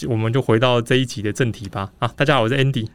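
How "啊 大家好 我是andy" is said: ordinary delivery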